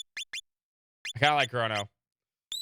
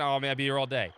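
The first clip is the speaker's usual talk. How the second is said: ordinary delivery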